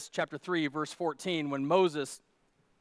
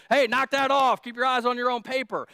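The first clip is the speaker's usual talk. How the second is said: loud, sharp mock telling-off; teacher yelling; 6 semitones higher, 7 dB louder than usual. Teacher catching a kid peeking during a test, mock yell. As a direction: bark at the kid like a strict teacher. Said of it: "unless you're yelling at the kid".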